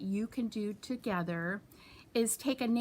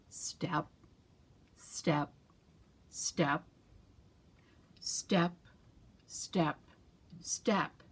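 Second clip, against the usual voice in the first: slow turtle steps; 5 semitones lower, 4 dB quieter, much slower than usual. A narrator's reading.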